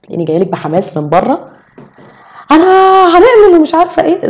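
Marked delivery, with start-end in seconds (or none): 2.5-4.3 high, loud burst of enthusiasm; loud excitement. Shown as a demonstration. Showing how she storms in when enthusiastic, loud and excited. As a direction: burst in bubbling over, pitch way up. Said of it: "تلاقيني صوتي عالي قوي، تلاقيني جايلك بحماس".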